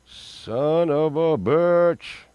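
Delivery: slow, stretched son of a bitch; drawn-out curse. Real, not acted. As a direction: let it out slowly in dismay.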